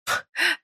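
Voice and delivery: short, high burst of laughter; scoffing laugh. A narrator's reading. Jilted woman hearing her ex's claim, scoffing laugh. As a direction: let a brief laugh escape in disbelief.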